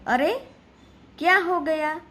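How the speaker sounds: a teacher acting out sudden alarm for the class; surprised. Shown as a demonstration. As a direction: react as if something just went wrong. Reads an exclamation example.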